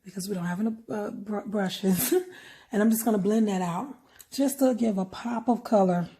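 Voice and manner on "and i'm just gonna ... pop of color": talking while chewing gum. Real, not acted. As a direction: chew gum through the words. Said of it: "sorry about my gum chewing".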